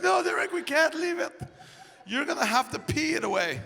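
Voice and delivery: high-pitched mock pleading; imitating an insistent, upset girlfriend. Acts girlfriend pleading with him.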